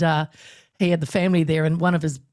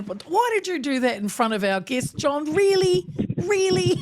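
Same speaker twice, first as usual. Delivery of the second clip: higher, half-joking telling-off, the last "Really" shouted; mock scolding; 9 semitones higher than usual. Wife scolding John in front of the guests, mock outraged. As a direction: playfully scold him, rising to a shout. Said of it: "joking abuse from his wonder".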